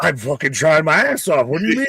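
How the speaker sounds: loud high defensive whine; mock outrage. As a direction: protest loudly, high-pitched.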